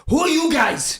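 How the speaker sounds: high-pitched, booming mock question; brash imitation. New signing confronting the reserves, brash call. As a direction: call it out across the room.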